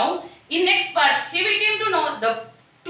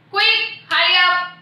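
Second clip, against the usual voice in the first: sudden raised harsh call, higher and louder; harsh cry; 5 semitones higher, 5 dB louder than usual. Acted. Teacher voicing the bird summoning robbers, harsh loud call. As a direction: bark it out as the bird calling its friends. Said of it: "a harsh voice".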